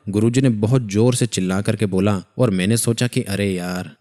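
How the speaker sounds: normal talking voice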